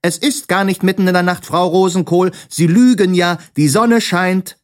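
high, cheeky yell back; shouting back. A narrator's reading. Sams on the cupboard answers the landlady, high shouting. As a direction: call back high and defiant. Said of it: "schrie das Sams vom Schrank oben zurück".

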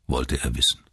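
ordinary delivery, nothing marked

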